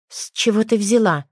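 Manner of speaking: ordinary delivery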